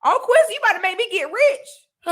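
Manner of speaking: high, loud happy thanks; delighted thanks. Real, not acted. Thanking a viewer for a super chat, high and thrilled. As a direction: gush over the super chat. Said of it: "thank you so much for the super chat".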